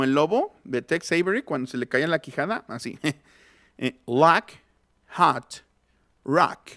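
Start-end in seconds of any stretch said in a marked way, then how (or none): 4.1-6.7 "lock, hot, rock" one by one, wide open A; "rock" sounded as "rak"; open vowel. Shown as a demonstration. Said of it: "abriendo más la boca".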